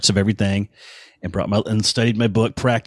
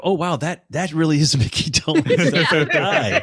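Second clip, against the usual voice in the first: higher, awed and delighted; starstruck awe; 7 semitones higher than usual. Retold or imitated. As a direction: gush like meeting a childhood hero. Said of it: "that first moment when you go".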